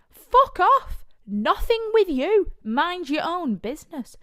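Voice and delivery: high, loud outraged telling-off, slowing down; a pushy man in the club gets told off loudly; furious shout. Retold or imitated. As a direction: scream them away, then slow and firm. Acted retort to men in club.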